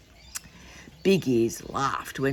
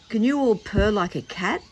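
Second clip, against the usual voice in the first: usual speaking voice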